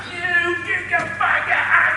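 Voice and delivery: high, slow, strained yell; mock cussing patient. Patient cursing at everyone in the room, high strained mock yell. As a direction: play the furious patient swearing. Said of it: "just cussing everybody out".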